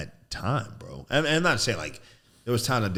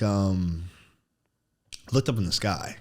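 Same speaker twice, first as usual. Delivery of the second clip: normal talking voice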